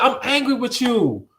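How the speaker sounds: high-pitched, exasperated scolding; acting out being mad at someone; retold anger. Housing worker scolds a pregnant teen, high retold anger. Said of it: "I told her, I said".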